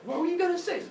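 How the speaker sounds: put-on high, innocent child voice; curious children. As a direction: pipe up as a puzzled kid. Children question the threat.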